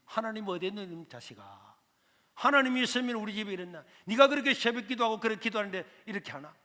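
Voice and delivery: 크게 울분을 터뜨리는 어머니; 하나님이 어디 있냐고 크게 따지며 울부짖기; crying angry mother. Retold or imitated. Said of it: "울고 있는 저희 어머님". (가난에 지쳐 아들에게 울며 크게 따지는 어머니)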